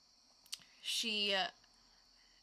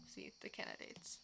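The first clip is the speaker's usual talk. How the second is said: whispered hint; 16 dB quieter than usual. Acted.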